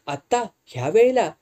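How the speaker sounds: incredulous query, pitch lifting at the end; surprised question. A narrator's reading. Nurse to driver over a late summons, surprised question. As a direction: ask it taken aback, rising.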